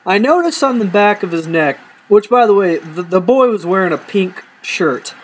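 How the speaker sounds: normal talking voice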